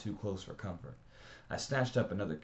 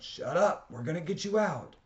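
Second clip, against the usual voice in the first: put-on much higher, louder yell at a screaming child; Johnny yelling at the screaming kids in the corner; 11 semitones higher, 7 dB louder than usual. A narrator's reading. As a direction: yell to cut through the crying. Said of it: "and Johnny yelled".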